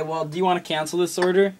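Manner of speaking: ordinary delivery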